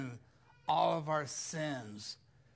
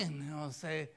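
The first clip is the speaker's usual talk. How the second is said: ordinary delivery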